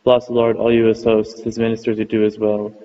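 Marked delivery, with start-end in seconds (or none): none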